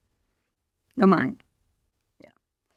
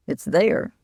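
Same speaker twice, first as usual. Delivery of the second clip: ordinary talk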